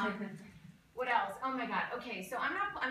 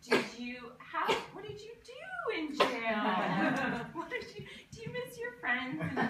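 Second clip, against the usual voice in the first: high, chirpy fake-cheerful questions; mock polite small talk; 6 semitones higher, 4 dB louder than usual. Comedian imagining small talk with ex-convict son, high chirpy. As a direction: play the sweetly awkward host. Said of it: "Am I gonna be like".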